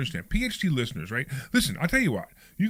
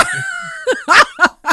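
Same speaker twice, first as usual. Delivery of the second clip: big explosive laugh; loud burst of laughter; 12 dB louder than usual. Real, not acted. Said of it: "That's funny".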